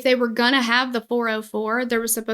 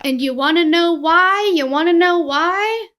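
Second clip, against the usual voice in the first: louder, higher teasing question; excited teasing; 6 semitones higher, 6 dB louder than usual. Real, not acted. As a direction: tease the reveal excitedly. Building suspense.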